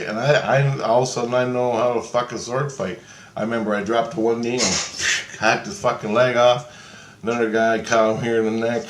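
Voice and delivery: plain reading voice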